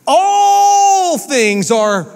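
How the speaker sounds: high, loud and very slow, straining on all things; impassioned cry. Acted. Preacher cries out to the congregation, very slow and loud. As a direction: cry it out like a preacher at full force.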